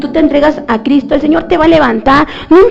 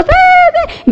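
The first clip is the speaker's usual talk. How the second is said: much higher squeaky yes, yes; mock eager agreement. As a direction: parody an overexcited believer. Emotional believers agree eagerly.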